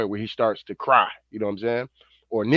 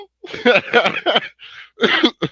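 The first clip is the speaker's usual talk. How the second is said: laugh; 10 semitones higher, 5 dB louder than usual. Real, not acted. Laughs at own impression.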